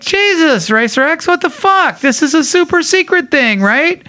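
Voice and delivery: betrayed disbelief at a friend spilling a secret; shocked outburst. Retold or imitated. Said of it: "And I'm like".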